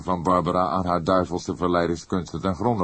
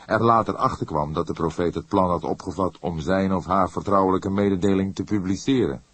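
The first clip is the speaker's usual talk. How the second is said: ordinary delivery, nothing marked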